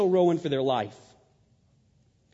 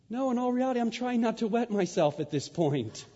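ordinary talk, nothing marked

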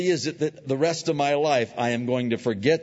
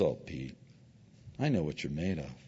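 gentle knowing Lord; 7 semitones lower, 7 dB quieter than usual. Retold or imitated. The Lord answering Peter, soft and knowing. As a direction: lower and softer, tender. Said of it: "The Lord goes".

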